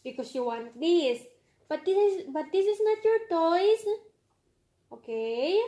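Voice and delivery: normal talking voice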